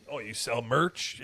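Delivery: ordinary delivery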